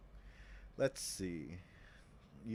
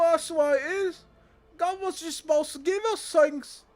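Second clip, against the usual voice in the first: much higher, louder complaining worker voice; whiny worker; 11 dB louder than usual. Acted. Striking worker whining to the leader, high and loud. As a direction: squeal like an entitled striker demanding free stuff.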